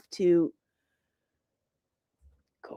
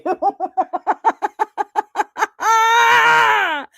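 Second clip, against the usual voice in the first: shrieking laugh; 12 semitones higher, 6 dB louder than usual. Real, not acted. Laughing fit after prank.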